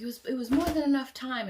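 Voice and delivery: quick hearty answer; lightly voiced Hagrid. Hagrid answering the friends, lightly voiced and quick. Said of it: "he was like".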